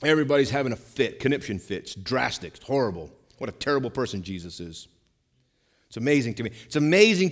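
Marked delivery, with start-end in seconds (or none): none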